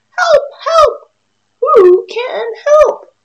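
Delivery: high, loud cry for help; a stuck duck yelling high and loud; squeaky desperate duck shout; duck calling for help. Acted. Duck calling, narrator says so.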